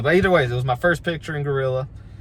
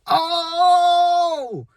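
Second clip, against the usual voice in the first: long, high, loud howl; mock group outcry; 5 dB louder than usual. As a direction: holler like a whole crowd reacting at once. Group of wrestlers reacting in chorus.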